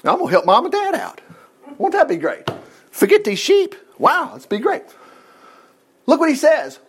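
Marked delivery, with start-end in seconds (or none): 0.0-4.8 high, wide-eyed daydreaming; David's excited thoughts. Acted. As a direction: think aloud like a thrilled kid. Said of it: "is thinking".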